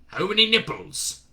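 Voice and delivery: put-on a drill sergeant's regional accent; Shadwell's wandering accent. Shadwell interviewing Newton in a regional accent. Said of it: "accent was unplaceable".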